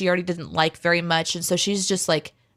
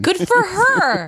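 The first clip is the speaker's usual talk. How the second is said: delighted cheer; 12 semitones higher, 5 dB louder, slower than usual. Real, not acted. Cheering the wife on.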